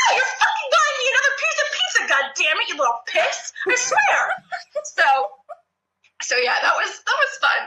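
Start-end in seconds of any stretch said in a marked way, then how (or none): 0.1-4.4 retold yelling.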